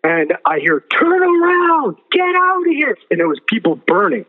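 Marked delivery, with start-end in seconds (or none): 0.8-3.0 very high, slow, eerie voice calling a warning; otherworldly warning voice. Retold or imitated. Man retelling hell recalls a voice ordering him out, high and eerie. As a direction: stretch it out high and strange, like a voice from beyond.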